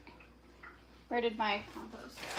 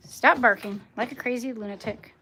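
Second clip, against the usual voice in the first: loud telling-off; scolding the dog; 8 dB louder than usual. Real, not acted. Owner to her barking dog, loud scolding. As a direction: tell the dog off in a raised voice.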